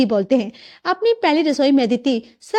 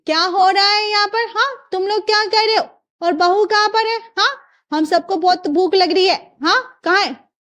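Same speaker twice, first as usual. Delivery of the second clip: high-pitched, demanding questions; bossy mother-in-law, pitched up; suspicious mother-in-law; 7 semitones higher than usual. Acted. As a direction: interrogate everyone in the room.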